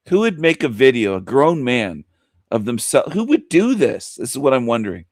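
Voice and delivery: ordinary talk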